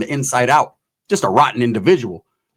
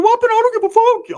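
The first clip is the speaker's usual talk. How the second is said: very high hyphy squeak; hyped-up falsetto mouse impression; Mickey Mouse voice. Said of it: "His Mickey Mouse fucking".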